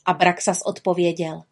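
plain reading voice

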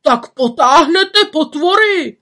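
high, loud, drawn-out angry yell; shouting. A narrator's reading. Impatient coachman curses his straining horses, shouting. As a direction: lean on each word, yelling at the team. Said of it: "křičel".

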